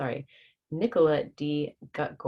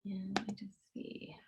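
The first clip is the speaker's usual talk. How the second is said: whisper; 5 semitones higher, 16 dB quieter than usual. Real, not acted. Aside while setting up.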